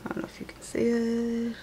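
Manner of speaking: soft unvoiced aside to the viewers; whispering to viewers about the hard-to-see card; whispered aside. Real, not acted. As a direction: drop to a whisper for a quick aside.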